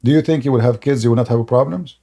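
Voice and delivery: ordinary talk, nothing marked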